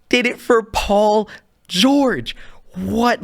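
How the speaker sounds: slow, high-pitched disbelief, pausing before the name; incredulous outrage. Real, not acted. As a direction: draw it out, utterly appalled.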